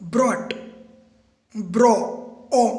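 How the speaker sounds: ब्रॉट then ब्रो, then the vowel ओ alone; sounding out. Shown as a demonstration. Teacher sounding out brought to find its vowel. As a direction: break the word down to its vowel. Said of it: "हमको खाली sound करके देखना है।".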